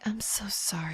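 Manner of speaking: imitated whispery, pitying mum; mock tender whisper; mum's hushed sympathy. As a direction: barely voice it, like a doting mother consoling. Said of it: "yeah, she says, like".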